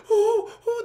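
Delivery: high, loud startled "oh, oh"; startled gasp. Retold or imitated. Heroine spots a tall woman in the alley, high startled gasp. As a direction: gasp as if caught off guard.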